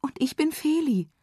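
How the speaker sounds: put-on high bright girl voice. A narrator's reading. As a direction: answer cheerfully. Feli answering the frog.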